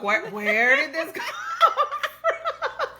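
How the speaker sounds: high, slow laughing bewilderment; puzzled and cracking up; laughing disbelief. Retold or imitated. Retold sudden crying.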